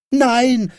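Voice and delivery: high, loud, defiant cry; high-pitched bratty protest; shouted refusal. A narrator's reading. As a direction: yell it like a stubborn little boy. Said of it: "schrie Häwelmann".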